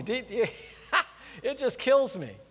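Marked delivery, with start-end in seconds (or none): none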